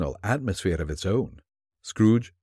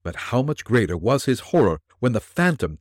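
ordinary delivery

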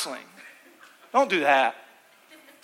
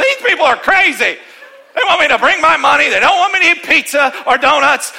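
mock indignant newcomer; 7 semitones higher, 8 dB louder than usual.

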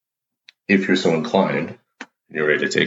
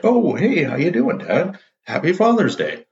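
much higher, breezy fake-casual greeting; acting totally normal; 12 semitones higher than usual. Son greeting dad from the shower, overly casual and high. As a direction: sing it out like nothing's odd. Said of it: "Acting like it's totally normal".

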